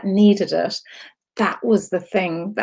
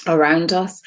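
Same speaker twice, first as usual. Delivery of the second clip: normal talking voice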